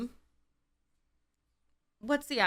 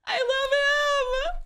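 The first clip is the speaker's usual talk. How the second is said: teary gush; 4 dB louder, slower than usual. Real, not acted. Fan overwhelmed by favourite actor.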